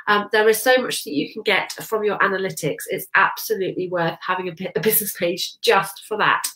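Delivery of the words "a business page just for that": ordinary talk, nothing marked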